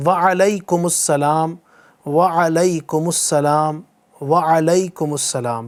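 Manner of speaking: slow "وعلیکم السلام" with a long "wa-a-lai-kum"; correct reply pronunciation. Shown as a demonstration. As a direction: model "وعلیکم السلام" slowly for the class to repeat. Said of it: "یہ اس انداز سے جواب دینا ہے". ("وعلیکم السلام" آہستہ، لمبے "وا" کے ساتھ)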